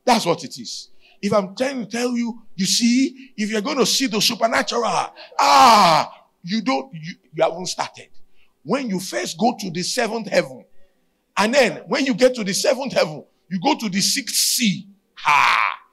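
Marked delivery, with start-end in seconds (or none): 1.2-14.9 high, loud preaching build; fiery preaching. Real, not acted. Preaching at full force. 15.1-15.8 loud ha. Real, not acted. Loud exclamation.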